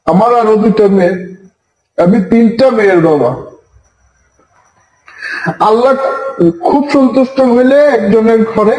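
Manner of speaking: tearful, strained high voice; choking back tears while pleading; snotty crying. Real, not acted. Father pleading live, voice raised. (কান্নাভেজা গলায়)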